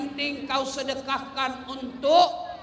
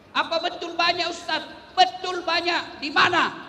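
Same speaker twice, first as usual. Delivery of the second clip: challenging; 6 semitones higher, 3 dB louder than usual. Real, not acted. Challenging the congregation.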